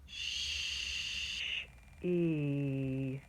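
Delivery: "Sh-, E" split into slow separate sounds; sounding out. Shown as a demonstration. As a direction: sound out "Sh-" then "E" apart. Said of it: "Here's the first word I'm gonna sound out".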